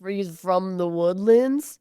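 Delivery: slow mock Californians-Cajun drawl; slurred Californians-Cajun accent. Date saying where he's from, slurred Californians-Cajun drawl. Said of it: "how they slur their words together".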